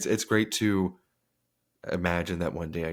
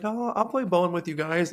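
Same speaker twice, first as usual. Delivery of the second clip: mom's cheerful offer; 9 semitones higher than usual. Retold or imitated. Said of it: "she'd be like".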